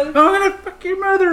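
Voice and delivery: put-on very high, childish taunt; little kid voice. Imitating young online gamers' taunt, very high. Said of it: "little eight-year-old kids".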